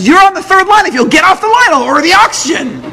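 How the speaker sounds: high, loud urgent relay; excited quote. Retold or imitated. Doctor relaying the oxygen official's words, high and urgent. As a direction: pass on the urgent order loudly. Quoting official, raised pitch.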